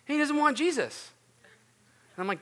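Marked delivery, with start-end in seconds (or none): 0.1-1.1 high, loud incredulous complaint; mock dismay. Friend reporting the man he discipled said no, mock dismay. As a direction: imitate a baffled friend's outburst.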